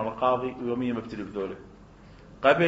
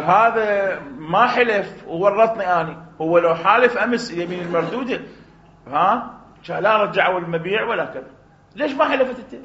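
angry complaint; 5 semitones higher, 5 dB louder than usual. Acted. Principal complains to judge.

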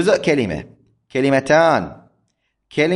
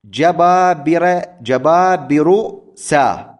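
very slow, higher and louder, جبابر سا drawn out syllable by syllable; slow scansion; 4 semitones higher, 3 dB louder, much slower than usual. Shown as a demonstration. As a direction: stretch جبابر سا out to mark the metre. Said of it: "هذا على وزن". (نطق جبابر سا ببطء شديد مقطعًا مقطعًا لبيان الوزن)

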